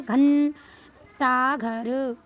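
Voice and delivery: घंटा घर slowly, with a long held n in घं; drawn-out anusvara. Shown as a demonstration. Teacher recapping the rule, drawn-out anusvara. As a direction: sound out घंटा घर with stretched nasal. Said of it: "ऐसे समझ में आ गई".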